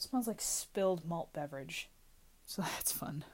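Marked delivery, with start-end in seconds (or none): 2.4-3.2 dry whispered aside; sarcastic whisper. Real, not acted. Alone in a smelly closet, a dry sarcastic whisper. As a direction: whisper it wryly to yourself.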